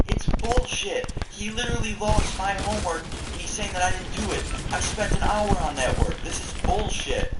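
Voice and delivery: high, slow, furious complaint; angry rant. Retold or imitated.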